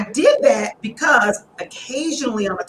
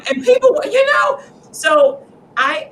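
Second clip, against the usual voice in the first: normal talking voice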